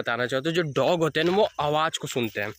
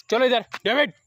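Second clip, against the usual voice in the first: calling the dog; 8 semitones higher, 4 dB louder than usual. Real, not acted.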